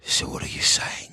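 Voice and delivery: threatening hushed voice, played for laughs; menacing whisper. Comedian showing how British actors add threat, menacing whisper. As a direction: whisper it like a movie villain. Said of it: "what they're saying is even more threatening".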